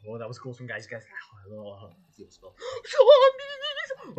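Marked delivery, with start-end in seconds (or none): 2.8-4.2 very high, very loud shriek; panicked scream. Pretend. Viewer spots zombies in the cave, high shriek. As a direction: scream it in hammed-up terror.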